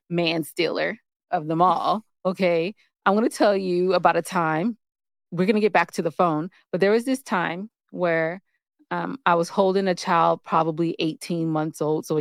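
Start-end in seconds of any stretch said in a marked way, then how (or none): none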